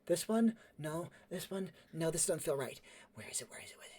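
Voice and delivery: hushed, questioning self-talk; soft, fading, preoccupied muttering; muttering while searching. Acted. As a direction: whisper to yourself as you rummage. Character searching, muttering to himself.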